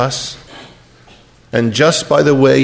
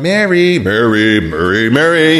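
different inflections; 3 dB louder than usual. Shown as a demonstration. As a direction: call the name four ways, each one personal. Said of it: "a different Mary for each one".

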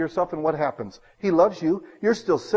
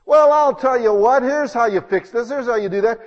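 loud, high, insistent know-it-all; pushy advice-giver; 6 semitones higher, 6 dB louder than usual. Acted. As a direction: push your fix on them, pitched high.

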